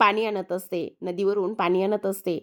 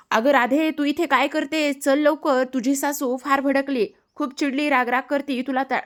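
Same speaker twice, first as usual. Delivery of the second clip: higher-pitched, hurried-messenger alarm; urgent warning; 5 semitones higher than usual. Acted. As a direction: call her away urgently, voice pitched up. Boy runs up with urgent warning.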